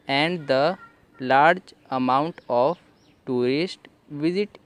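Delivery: slow, careful English reading, word by word; slow reading aloud. Shown as a demonstration. Teacher reading the English text slowly to students. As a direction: read the sentence out slowly for learners. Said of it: "तो ऐसे आप पढ़ सकते हैं".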